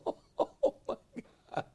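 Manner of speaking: ordinary talk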